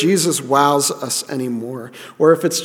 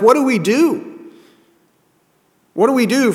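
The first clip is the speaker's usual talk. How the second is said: higher, eager voice of the captivated crowd; awed crowd asking; 7 semitones higher than usual. Retold or imitated. People captivated by preaching ask, eager and higher. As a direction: voice them leaning in, desperate to know. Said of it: "they're like".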